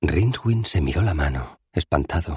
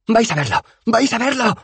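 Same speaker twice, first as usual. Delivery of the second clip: high manic boast, climbing higher; crazed shrieking; 3 dB louder than usual. A narrator's reading. Rincewind promises his companions proof, shrill and crazed. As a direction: squeal it madly, higher on the repeat. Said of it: "chilló enloquecido".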